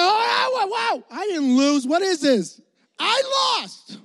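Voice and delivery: high, cracking, on-the-verge cry-shout; a kid fighting back tears and yelling; mock tearful outrage. Boy holding back tears at losing, high cry-shout. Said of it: "I'm holding back the tears 'cause I'm furious".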